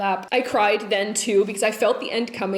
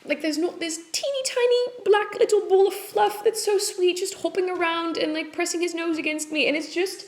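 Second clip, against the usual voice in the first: cooing much higher, tender gush over a tiny pet; high doting coo; 9 semitones higher than usual. Real, not acted. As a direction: coo about the little ball of fluff. Gushing about her tiny bunny.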